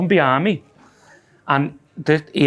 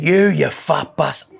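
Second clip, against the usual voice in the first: imitating the man. Voicing the man's insult.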